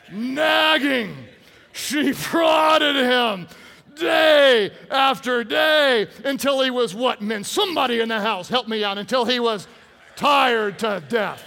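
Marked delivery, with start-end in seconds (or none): 0.1-7.2 loud, high, drawn-out shout; hammered-out preaching. Real, not acted. Preacher teasing the ladies with the verse, shouting slowly. 9.0-11.4 loud, stretched-out words; hammered-out preaching. Real, not acted. Preacher finishing the verse for laughs.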